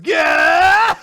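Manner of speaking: long, high screamed cry; loud scream. Retold or imitated. Imitating Asta's reaction, long high mock scream. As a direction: scream it out at full voice. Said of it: "he goes".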